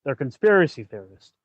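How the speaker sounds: ordinary talk, nothing marked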